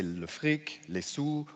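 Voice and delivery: le fric, les sous in French; French slang le fric, les sous; French pronunciation. Shown as a demonstration. Showing French slang for money, French sounds. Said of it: "people say".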